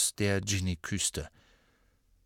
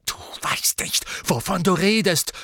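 hissed start, then high loud snarl; raging; 8 semitones higher, 4 dB louder than usual. Acted. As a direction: storm at her. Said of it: "tobte Ron".

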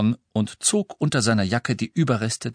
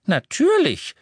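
high, loud proud exclamation; proud old lady; 4 dB louder than usual. A narrator's reading. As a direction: say it like a proud grandmother. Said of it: "sagte die alte Dame stolz".

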